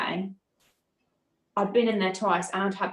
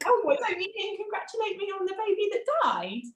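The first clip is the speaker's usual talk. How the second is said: high, cutting sarcastic comeback; bitter retort; 13 semitones higher than usual. Retold or imitated. Grieving mother snapping at the midwife, high and sarcastic. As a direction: throw it back at her with a sharp high edge. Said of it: "But I was so angry".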